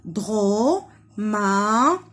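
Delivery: Dromadaire as "dro... ma... dai... re", very slow; syllable by syllable. Shown as a demonstration. Teacher tapping syllables for children, Dromadaire broken in four. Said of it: "on tape les syllabes".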